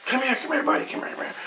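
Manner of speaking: high, soft coaxing call, half-whispered; coaxing call to the dog. Retold or imitated. Dreamer coaxing his hurt dog to come, high and soft. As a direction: sweet-talk a scared dog over to you. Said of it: "I'm like".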